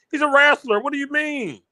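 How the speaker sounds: high, loud disbelief at the comparison; incredulous outburst. Real, not acted. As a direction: cry out that he's a wrestler.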